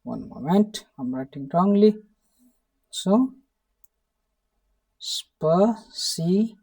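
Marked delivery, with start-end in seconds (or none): 5.0-6.6 спасибо said slowly, with the o sounding as a; pronunciation demo. Shown as a demonstration. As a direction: drag спасибо out, o spoken as a. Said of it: "this O becomes A while pronouncing".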